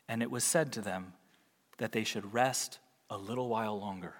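soft, low, gentle comfort; tender reassurance. Acted. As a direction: soothe the weary martyrs as God. God comforting the martyrs.

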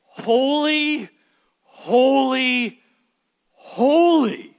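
preacher voicing the angels' loud triple holy; ringing proclamation. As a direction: proclaim it like angels crying out in heaven. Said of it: "this triad and say".

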